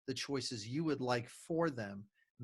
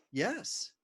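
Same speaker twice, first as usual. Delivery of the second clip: put-on high little-kid yes; six-year-old son; 8 semitones higher than usual. Dad imitates his son agreeing to ice cream later, high childlike. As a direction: chirp it like a happy child.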